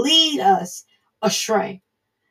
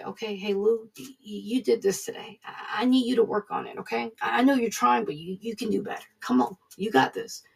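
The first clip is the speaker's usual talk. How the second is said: much softer, tender coaxing as God; gentle encouragement; 7 dB quieter than usual. Retold or imitated. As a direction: coax him along like a patient coach. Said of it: "God will bring it to your attention".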